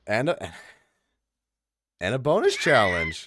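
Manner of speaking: understated, not super excited; unexcited. Real, not acted. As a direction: say it without much excitement. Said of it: "You don't sound super excited".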